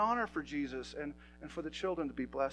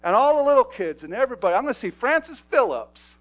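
shouted preaching; 11 semitones higher, 22 dB louder than usual. Acted. Pastor tells the church heaven is full of kids, shouting. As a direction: roar it out to the back row.

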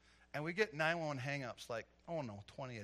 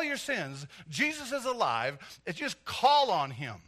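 full-force preaching; 2 semitones higher, 10 dB louder than usual. Acted. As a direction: drive each phrase home to the congregation.

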